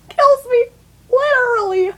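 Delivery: high laughing voice through the words; real laughter bubbling over the line. Real, not acted. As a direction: laugh while you say it. Laughs at her own story.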